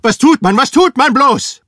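high, loud, frantic shouting; schreien. A narrator's reading. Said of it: "schrie er". (hoch und laut, verzweifelt schreien)

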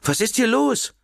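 very high, shrill demand; piercingly high question, not shouted. A narrator's reading. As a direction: pitch it right up like an alarmed mother. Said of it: "mit schriller Stimme".

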